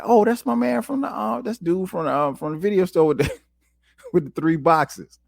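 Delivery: high, excited "oh, that's him!"; surprised recognition. Retold or imitated. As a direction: pitch jumps up as he spots his neighbor, laughing at the end. Recognizing neighbor, retold excitement.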